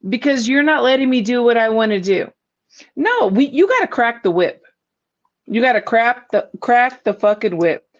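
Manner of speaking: usual speaking voice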